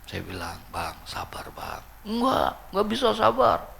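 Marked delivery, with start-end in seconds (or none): 0.7-1.8 soft, low retold reassurance; calm him down under your breath; quiet soothing. Calming the wailing widower. 2.1-3.7 high mock-tearful refusal; imitated wailing complaint. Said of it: "Tuh, ngeluh".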